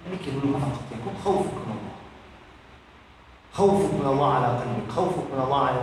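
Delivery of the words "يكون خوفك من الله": normal talking voice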